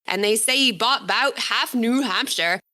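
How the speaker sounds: a lightly put-on old New Hampshire country accent, read from the book. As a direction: voice the book's old New Hampshire character in his rural accent. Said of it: "excuse my accent".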